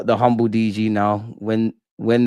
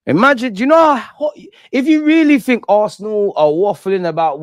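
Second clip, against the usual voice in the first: real shout; 9 semitones higher than usual. Real, not acted. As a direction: blast it at the rival fans. Heated rant at rival fans.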